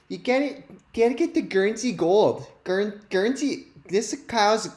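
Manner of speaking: put-on doing the lady from the Guernsey ad, high and sing-song with a Minnesota accent; impersonating the milk ad lady. Host mimics the milk ad lady for his audience, high Minnesota accent. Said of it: "my impersonation of that lady from the video".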